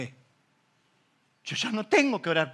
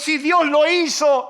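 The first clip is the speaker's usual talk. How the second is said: loud, slow, much higher proclamation of si Dios lo hizo; proclaimed loud; 12 semitones higher, 13 dB louder, slower than usual. Acted. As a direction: shout si Dios lo hizo to the room. Loud preaching take. (si Dios lo hizo, proclamado fuerte y lento)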